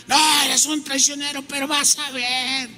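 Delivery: high, sustained wailing accusation; betrayed accusation. Acted. Offended man calling his friend a traitor, betrayed. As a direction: act out an offended friend's threat. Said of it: "de sentirse traicionado".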